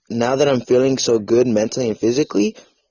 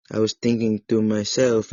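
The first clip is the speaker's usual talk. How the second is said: normal talking voice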